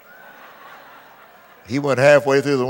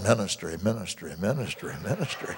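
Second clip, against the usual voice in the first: mock madman muttering; 9 semitones lower, 12 dB quieter than usual. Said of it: "pounding on the padded wall saying".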